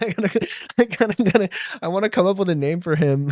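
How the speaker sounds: giggly. Real, not acted. Laughing, excited about new persona.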